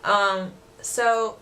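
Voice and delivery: ordinary delivery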